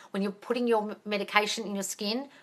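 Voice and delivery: ordinary talk, nothing marked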